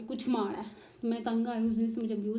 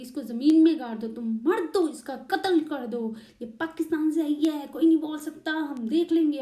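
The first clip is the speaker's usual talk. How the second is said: louder, higher furious father-in-law voice; menacing order; 5 semitones higher, 7 dB louder than usual. Retold or imitated. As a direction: urge the son to kill her. ससुर का कत्ल का हुक्म. (ससुर की नकल में ऊँची, गुस्से भरी धमकी)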